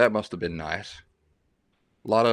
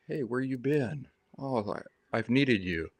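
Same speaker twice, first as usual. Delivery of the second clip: much softer mock-sweet greeting; sweetly; 4 semitones higher, 7 dB quieter than usual. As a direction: coo it lovingly like a sweet old grandma. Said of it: "she didn't say it all sweetly like".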